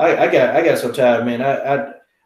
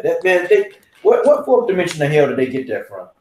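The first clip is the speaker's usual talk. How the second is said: ordinary delivery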